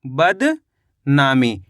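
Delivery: बदनामी as a drawn-out बद, a pause, then नामी; slow split reading. Shown as a demonstration. Teacher reading back the finished word, slowly split in two. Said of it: "तो मिलके बन गया".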